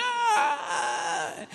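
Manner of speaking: put-on loud high-pitched toddler bawl; imitated child's long cry; hammed-up kid wail; mock crying wail. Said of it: "he's just crying".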